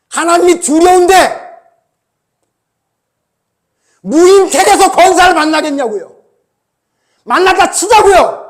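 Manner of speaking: loud, urgent pulpit voice; full-force sermon, hammering every question; fiery preaching. Acted. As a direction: preach it like a rebuke to the church.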